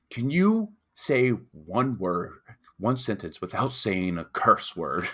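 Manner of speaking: slow, measured challenge; intimidating bouncer. Retold or imitated. Bouncer challenging a cursing English kid, slow and intimidating. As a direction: lay it out word by word like a bouncer. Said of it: "super intimidating. And he was just like".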